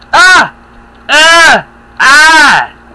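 loud high strained cries; pained grunts. Acted. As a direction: cry out as if struck. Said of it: "Lots of grunting".

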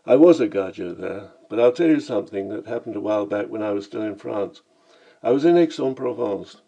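usual speaking voice

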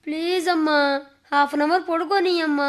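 pleading whine. Acted.

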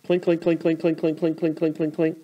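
clinking coaster chain. Shown as a demonstration. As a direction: imitate the chain pulling the car uphill, playfully. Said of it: "now I'm gonna start clinking".

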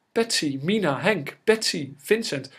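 high, loud cries for help; frantic calling out. A narrator's reading. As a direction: shout desperately up the stairs to summon everyone. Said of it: "riep aan de trap". (paniekerig om hulp roepen)

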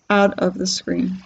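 ordinary delivery, nothing marked

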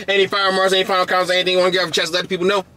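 rattled off fast like a car ad; fast car salesman. Real, not acted. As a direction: speed through it like a car salesman. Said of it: "you talking fast as hell".

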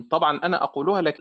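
ordinary delivery

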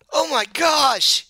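shocked high, loud cry; Iliard. Acted. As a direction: shriek it slowly in horror. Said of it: "Iliard, the, the halfling, say".

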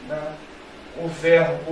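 usual speaking voice

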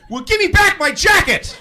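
high, loud shouted demand; mock indignant yell. Arguing with the driver about something else, mock yell. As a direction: act out yelling back in fake outrage. Yells back an unrelated argument.

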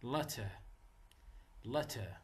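Letter with a soft weak uh at the end; schwa ending demo. Shown as a demonstration. Teacher models the final schwa in Letter, softly. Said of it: "هذا دائمًا بالأخير letter".

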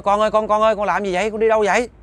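high, loud panicked call to his son; father screaming for his wandering son; frantic shout. Acted. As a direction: yell after the child walking away. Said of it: "Ông mới la lên".

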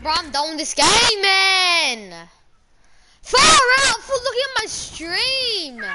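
frustrated yelling at the game; raging gamer. Real, not acted. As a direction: yell it out, fed up and done. Gamer rages at losing.

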